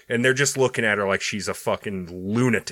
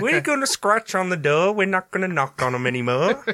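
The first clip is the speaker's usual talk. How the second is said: hammed-up posh British accent, dissolving into a laugh; mock-posh British accent, laughing at the end; 7 semitones higher than usual. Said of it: "we need to sound posh now".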